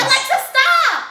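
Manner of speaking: loud, high yell of stop; shouting stop. Real, not acted. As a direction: shout it to cut the speaker off. Keeps telling Alexa to stop.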